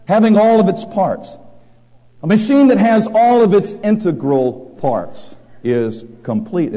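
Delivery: loud, high sermon voice, pressing each phrase; full-force preaching. Acted. Preacher to congregation, loud fervent preaching. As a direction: thunder it from the pulpit.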